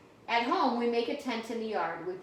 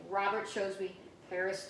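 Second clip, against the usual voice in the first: ordinary delivery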